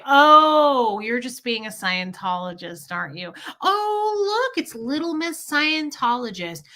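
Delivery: mock taunt with high, swooping exclamations. Husband belittling his wife for her former religion, mocking. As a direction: imitate a sneering partner's put-down.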